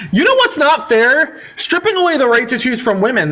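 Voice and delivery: impassioned rant. Real, not acted. Passionate political rant listing grievances.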